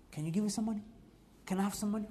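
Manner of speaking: soft, sheepish begging of others; embarrassed asking. Acted. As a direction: plead quietly, as if embarrassed to ask. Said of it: "most humiliating and embarrassing thing".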